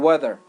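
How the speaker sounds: "weather" with D-like TH and final R; American "weather", low, R-coloured ending; American pronunciation. Shown as a demonstration. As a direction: say "weather" like "wedder".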